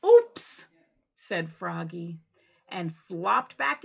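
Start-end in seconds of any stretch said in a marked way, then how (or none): 0.1-0.7 high loud surprised oops. Acted. Froggy remembering his Huggy, high and loud. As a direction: caught out, cry it.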